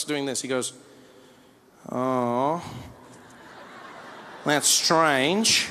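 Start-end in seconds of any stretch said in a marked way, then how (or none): 1.9-5.7 mock Australian puzzlement, drawn out slowly for everyone to hear; slow, loaded thinking aloud; pointed passive-aggressive. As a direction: stretch each phrase to make someone uncomfortable. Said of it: "try and speak loud and make you uncomfortable".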